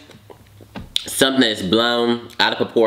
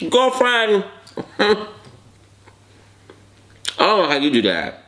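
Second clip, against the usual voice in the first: sassy; 10 semitones higher than usual. Acted. Sassy address to mukbangers.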